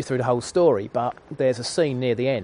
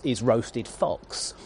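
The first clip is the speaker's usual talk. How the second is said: usual speaking voice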